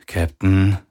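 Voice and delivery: plain reading voice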